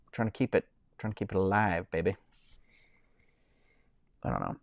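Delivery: normal talking voice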